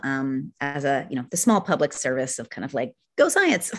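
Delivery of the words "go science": high, playful rallying cry; mock cheer. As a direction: cheer it like a tiny pep rally.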